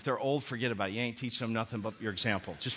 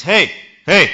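shouted call; 4 semitones higher, 19 dB louder than usual. Acted. Preacher acting Jesus calling across lake.

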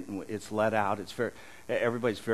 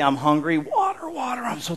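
parched plea; 10 semitones higher, 4 dB louder than usual. Pretend. Imagining risen man's first words.

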